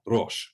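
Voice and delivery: Rosh with a rolled r and short o; Rosh, the r tapped or rolled, not English r. Shown as a demonstration. Teacher models Rosh for learners, rolled r. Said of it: "Have a go at pronouncing Rosh".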